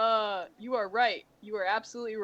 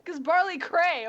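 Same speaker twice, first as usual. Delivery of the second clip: excited; 8 semitones higher, 5 dB louder than usual. Acted. Hyping up the character.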